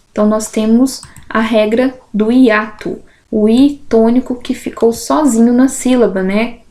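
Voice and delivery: ordinary delivery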